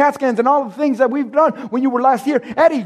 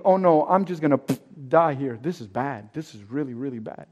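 hushed, sinking dread, far softer; scared inner voice; 7 semitones lower, 6 dB quieter than usual. Retold or imitated. Patient fearing a tumor, a hushed scared inner voice. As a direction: whisper your fear to yourself. Inner panic at the eye exam.

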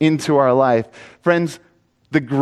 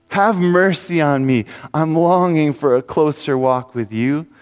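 fervent pleading sermon voice, pitched higher; preaching; 4 semitones higher than usual. Acted. Preacher urging the congregation to cry out to Jesus, fervent and pleading. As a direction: cry out to God with urgency.

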